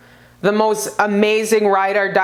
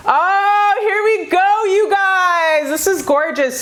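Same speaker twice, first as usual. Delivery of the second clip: excited; 9 semitones higher, slower than usual. Real, not acted. Reader thrilled at the card spread, bursting out excitedly. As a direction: burst out with delight at the cards.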